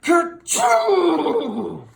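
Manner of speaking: loud, high kerchoo; explosive horse sneeze; huge sneeze. Acted. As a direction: blast out a giant sneeze. Robert sneezes.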